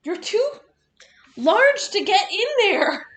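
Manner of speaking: high cooing pet voice. Real, not acted. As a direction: coo at the cat. Cooing at the cat.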